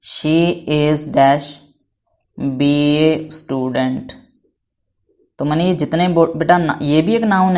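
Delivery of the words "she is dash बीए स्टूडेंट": very slow, word by word, for the class to write down; teacher dictating an example sentence to students; slow dictation. Shown as a demonstration. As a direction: dictate "she is dash बीए स्टूडेंट" slowly, with space between the words. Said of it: "एक और third लिख करके".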